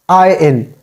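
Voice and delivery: "I N" as one word, iron with silent r; "I N" gliding from eye into in, no r; corrected iron. Shown as a demonstration. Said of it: "R is totally silent here".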